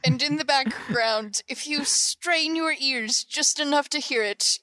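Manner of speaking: normal talking voice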